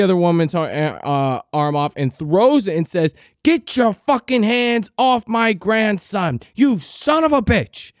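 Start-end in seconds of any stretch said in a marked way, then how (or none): 3.4-7.9 high, fierce grandma yell at the stranger. Retold or imitated. Grandmother yelling at the woman to let go of him, high fierce yell. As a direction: snap it out like a protective grandmother.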